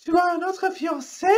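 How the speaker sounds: very high, startled question; shocked question. Acted. Rachel shocked at Antoine's secret, very high. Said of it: "questionne Rachel".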